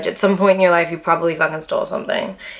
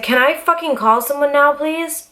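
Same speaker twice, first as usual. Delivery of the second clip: exasperated demand; 8 semitones higher than usual. Retold or imitated. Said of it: "I was like".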